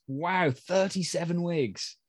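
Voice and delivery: ordinary delivery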